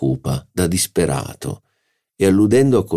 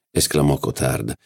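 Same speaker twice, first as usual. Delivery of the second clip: ordinary delivery, nothing marked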